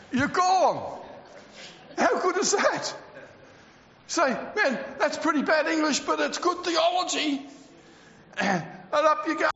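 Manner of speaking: high-pitched, excited pulpit delivery; fervent preaching. Acted. Preacher tells the congregation of the rapture, fervent preaching.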